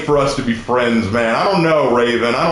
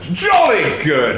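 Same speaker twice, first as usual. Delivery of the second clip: put-on drawn-out caricature British accent; mock British accent; 2 semitones higher, slower than usual. Showing off the British impression to chat, hammed-up British accent. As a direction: stretch it out like a cartoon Brit. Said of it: "How was my British accent?".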